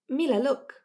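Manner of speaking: excited. A narrator's reading. Sister showing Mila her watch, excited. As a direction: grab her friend's attention. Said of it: "his sister said excitedly".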